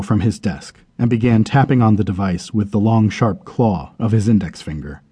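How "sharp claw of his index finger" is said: ordinary delivery, nothing marked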